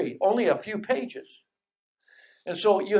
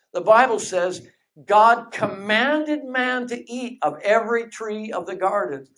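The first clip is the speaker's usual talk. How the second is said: normal talking voice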